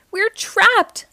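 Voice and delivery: high, loud alarmed cry; panicky cry. A narrator's reading. Pablo caught in the cage, panicky cry. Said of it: "cried Pablo".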